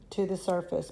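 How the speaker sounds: usual speaking voice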